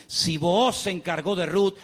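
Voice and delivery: a steady preaching build; building sermon. Acted. Preacher assuring the church that God provides, building. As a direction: lift the congregation phrase by phrase.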